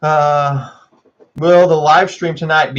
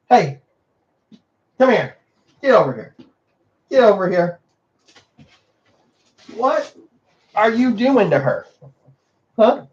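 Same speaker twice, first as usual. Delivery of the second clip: calling the dog; 8 semitones higher than usual. Real, not acted.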